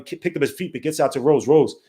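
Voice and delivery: ordinary delivery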